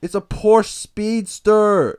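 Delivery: high, loud, drawn-out howl; mock freak-out. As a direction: wail the car's name in parody anguish. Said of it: "He was freaking out".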